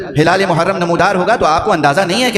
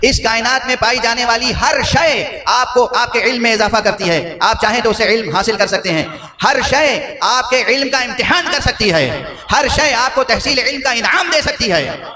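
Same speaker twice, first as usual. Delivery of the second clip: high-pitched sermon delivery, climbing to a peak; impassioned preaching; 7 semitones higher than usual. Real, not acted. Preacher telling the congregation all creation teaches, impassioned and rising. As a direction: preach it high, building to the climax.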